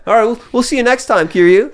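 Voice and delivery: high, fast friendly cop; loud, high mock cop farewell; cheery cop. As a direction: play the chummy policeman. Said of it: "cop voice in retold game".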